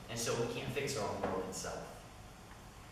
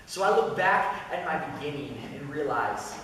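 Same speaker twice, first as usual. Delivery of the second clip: higher and louder, almost preaching in rhyme; spoken-word poem climax at full force; impassioned spoken word; 7 semitones higher, 8 dB louder than usual. Acted. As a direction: pour it out like a testimony on stage. Poem climax, rising passion.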